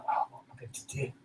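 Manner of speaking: breathy frantic whisper to himself; whispered worry. Retold or imitated. Alone at the stranger's gate, fast panicked whisper.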